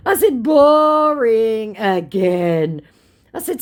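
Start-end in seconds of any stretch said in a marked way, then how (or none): 0.4-2.8 very slow, high and louder; slow, high "Boring again"; drawn-out bored put-down. Retold or imitated. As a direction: stretch "Boring" out in disdain.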